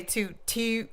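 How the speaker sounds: imitated Baltimore vowel on "toot"; mock Baltimore accent. Shown as a demonstration. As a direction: demonstrate "toot" with a fronted Baltimore oo. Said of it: "They say".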